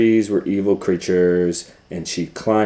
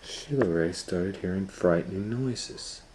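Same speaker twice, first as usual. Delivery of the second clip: plain reading voice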